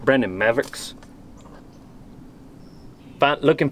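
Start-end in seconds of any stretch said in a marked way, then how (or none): 0.0-0.9 tough cowpuncher twang on Brandon Mavericks; punchy. Shown as a demonstration. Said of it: "Say it punchy".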